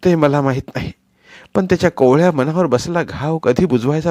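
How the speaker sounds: bitter rebuke. Acted. Wife rebukes husband.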